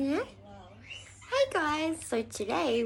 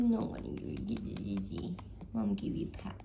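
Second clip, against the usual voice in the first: whisper; 6 dB quieter, faster than usual. Real, not acted. Whispering to the puppy.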